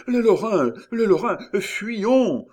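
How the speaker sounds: panicked cry. A narrator's reading. Miton urging flight from the Lorrains, panicked cry. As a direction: cry out in fright to flee. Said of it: "s'écria Miton tremblant".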